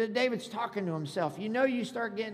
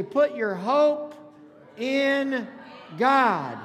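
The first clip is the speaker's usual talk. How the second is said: loud, drawn-out preacher's shout; rallying shout; 4 semitones higher, 8 dB louder, much slower than usual. Shown as a demonstration. Pastor leading neighbors to declare hope, rallying shout. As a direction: shout it with gaps between the last words. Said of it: "Shout to your neighbor beside you and say".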